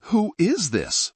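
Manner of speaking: high, slow, alarmed demand. A narrator's reading. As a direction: demand the caller's identity. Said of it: "Michael demanded".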